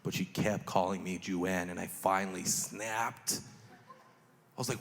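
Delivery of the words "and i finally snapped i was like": ordinary delivery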